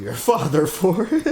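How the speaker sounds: laughing through the line; cracking up mid-sentence. Real, not acted. Breaks into laughter reading.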